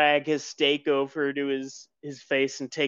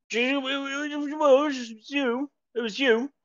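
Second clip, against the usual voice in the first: high, accusing, mouth full; suspicious diner jabbering at you; accusing NPC voice; 11 semitones higher than usual. Acted.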